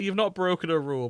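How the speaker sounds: ordinary talk, nothing marked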